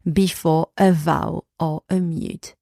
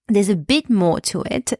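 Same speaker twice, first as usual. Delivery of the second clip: normal talking voice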